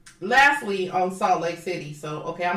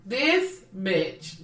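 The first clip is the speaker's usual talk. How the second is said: normal talking voice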